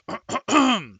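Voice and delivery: deliberate throat clearing; a loud prince clearing his throat before speaking; clears throat. Acted. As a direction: ahem before the vows. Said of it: "clears his voice".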